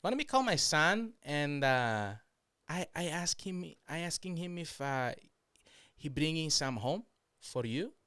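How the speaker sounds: put-on high voice, easing down; imitated coworker. Coworker offering to ring her son, high halting mock voice. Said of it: "And she goes".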